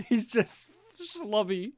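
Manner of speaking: normal talking voice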